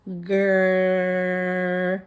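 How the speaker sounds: "grr" with the tongue pulled back, dark and held; growled R sound. Shown as a demonstration. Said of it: "you're gonna go like this and say".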